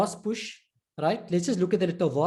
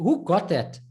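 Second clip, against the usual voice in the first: ordinary delivery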